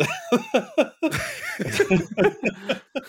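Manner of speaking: high, drawn-out giggling; real high-pitched laughter; long laugh. Real, not acted. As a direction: laugh helplessly at your own joke. Laughs at own tired joke.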